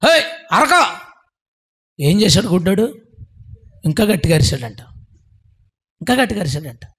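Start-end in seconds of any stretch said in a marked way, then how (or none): 0.1-0.9 mock scolding. Disciples hushing the shouting blind man.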